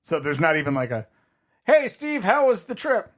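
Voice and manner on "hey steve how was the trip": cheery friend voice. Pretend. Imagined question from friend.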